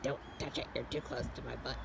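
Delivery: voicing the animal. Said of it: "He's like".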